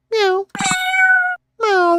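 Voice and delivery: a quick little cat sound; meow. Acted. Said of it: "they're both meowing".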